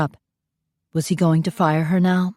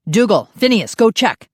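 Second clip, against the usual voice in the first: louder, sharp order; urgent command; 5 semitones higher, 4 dB louder than usual. Acted. Father ordering the guards, urgent. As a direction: order them out fast.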